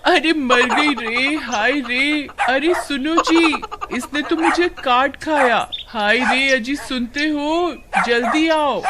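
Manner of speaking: high, alarmed cries for help; pained wailing. Acted. Suman calling her husband after a dog bite, pained wailing. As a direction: wail and call for your husband.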